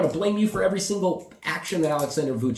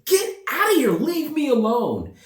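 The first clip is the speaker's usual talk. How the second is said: high-pitched yell, stretched at the end; retold angry yell; 9 semitones higher than usual. Traveler surrounded by people after his bag, retold yell. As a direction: shoo them away, voice pitched way up.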